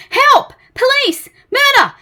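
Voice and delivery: very high, loud cries for help; panicked scream. Acted. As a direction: scream as if under attack. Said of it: "he screamed".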